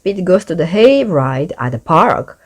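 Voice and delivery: usual speaking voice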